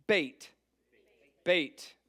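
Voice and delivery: "bait" with a long a and a firm b; Hebrew letter name. Shown as a demonstration. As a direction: say "bait" clearly, like the English word bait. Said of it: "I kinda give you a pronunciation there".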